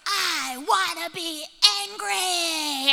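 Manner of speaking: slow, drawn-out tantrum cry; high, loud stretched words; angry child. Retold or imitated. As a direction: imitate a kid wailing in defiance. Son insists on his anger.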